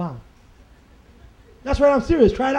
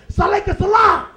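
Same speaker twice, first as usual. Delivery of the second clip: faking the funk; 11 semitones higher than usual. Acted. Comic mocks brothers faking the funk, hammed-up shout. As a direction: bellow it like someone faking the funk. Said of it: "ones who are faking the funk".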